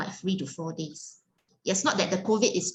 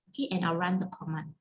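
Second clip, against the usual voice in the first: ordinary talk, nothing marked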